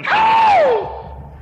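sudden very high, loud cry; startled sermon outburst; shout. Acted. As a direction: blast the word out.

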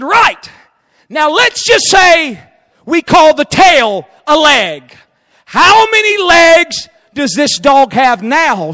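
a hint of Lincoln teasing the boy; lightly voiced Lincoln.

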